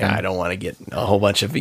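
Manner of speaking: ordinary talk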